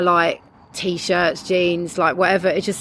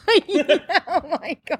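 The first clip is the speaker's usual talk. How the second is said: slow, high, laughing; laughing in disbelief; 9 semitones higher, much slower than usual. Real, not acted. Reacts to Jesus/sausage joke.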